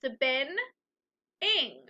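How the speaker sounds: Spin-ing broken into spin and ing with a gap; split into syllables. Shown as a demonstration. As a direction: separate spin from ing clearly. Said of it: "The root word is spin and the ending is ing".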